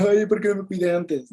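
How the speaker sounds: a touch of mock crying; mock whiny lament. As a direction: whine it like someone regretting too late. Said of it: "estás llorando y estás chillando".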